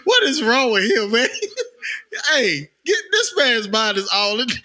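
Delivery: talking through high laughter; words squeezed out between laughs, voice high; laughing hard. Real, not acted. Teasing his co-host, laughing hard and high.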